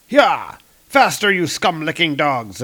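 rider's urging shout. Acted. Dark elf soldier to his riding dogs, urging shout. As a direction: drive the dogs on with a sharp cry.

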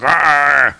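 loud drawn-out growling yell; battle roar. Acted. Old Thor overwhelmed by shadows, loud roar. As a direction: roar out in defiance. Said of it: "And he's like".

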